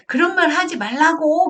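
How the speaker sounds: put-on loud, high outburst of a cross child; child's angry protest. Child telling mom not to say that, angry protest. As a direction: act out a kid snapping back at mom. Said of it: "화를 내면서 큰 소리로 말한단 말이에요, 아이도".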